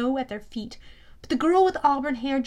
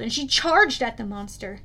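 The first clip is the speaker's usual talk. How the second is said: usual speaking voice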